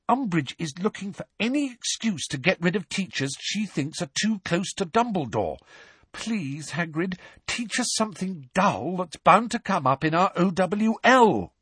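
high, urgent pleading; desperate pleading. A narrator's reading. Hermione pleading with Hagrid, high desperate urging. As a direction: beg him to see sense. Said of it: "a note of real desperation".

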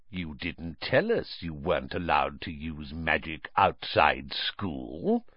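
low, soft, gloating menace; quietly sinister, ending on a questioning rise; menacing uncle. A narrator's reading. Uncle confronting Harry over magic, menacing. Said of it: "a mad gleam dancing in his eyes".

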